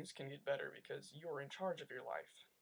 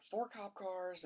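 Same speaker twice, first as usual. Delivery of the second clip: ordinary talk, nothing marked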